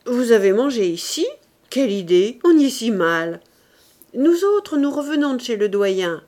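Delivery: mock the cheap restaurant loudly; loud scornful surprise. A narrator's reading.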